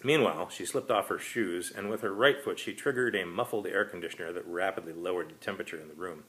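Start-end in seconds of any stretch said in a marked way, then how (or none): none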